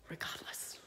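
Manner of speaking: a firm whisper. Real, not acted. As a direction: breathe the word out. Whispered emphasis.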